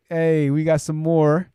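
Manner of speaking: slow, stretched out; slow drawn out. Real, not acted. Host greeting incoming chat comments, slow and drawn out. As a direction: savour each word, slowly.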